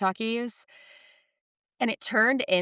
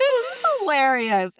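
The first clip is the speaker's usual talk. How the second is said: laughing; 9 semitones higher, 7 dB louder than usual. Real, not acted. Laughing while saying it.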